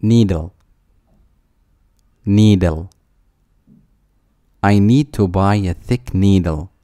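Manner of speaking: ordinary delivery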